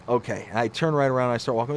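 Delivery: usual speaking voice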